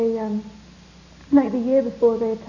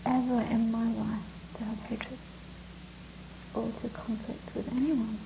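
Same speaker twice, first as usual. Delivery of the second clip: whispered confession; 9 dB quieter than usual. Real, not acted. Heartfelt confession to listeners.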